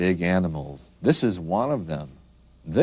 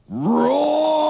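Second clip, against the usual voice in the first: the word "roars" stretched into a loud roar; high, loud, drawn-out roar; lion roar; 5 dB louder than usual. Shown as a demonstration. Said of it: "And a lion".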